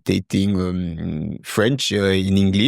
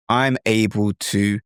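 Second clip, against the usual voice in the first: normal talking voice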